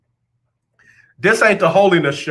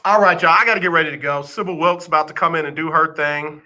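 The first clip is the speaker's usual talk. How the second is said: usual speaking voice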